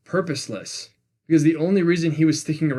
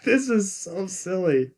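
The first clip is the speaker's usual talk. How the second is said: amused scoff; 4 semitones higher, slower than usual. Retold or imitated. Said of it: "I was just like".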